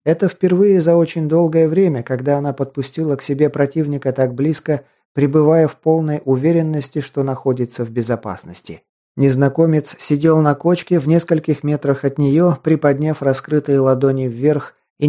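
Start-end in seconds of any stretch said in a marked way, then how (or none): none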